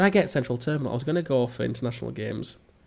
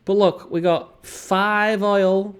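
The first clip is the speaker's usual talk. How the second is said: drawn-out boast; 6 semitones higher, 3 dB louder, much slower than usual. Acted.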